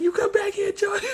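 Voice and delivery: high-pitched imitation of calling a runaway kid; mock calling after. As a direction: call him back in a strained-high mock yell. Uncle calling after fleeing child.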